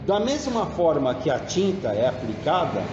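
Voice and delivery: ordinary delivery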